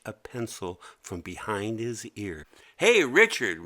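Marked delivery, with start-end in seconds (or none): 2.8-3.6 loud call across the room. Acted. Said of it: "Mimi called out".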